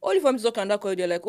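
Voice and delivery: usual speaking voice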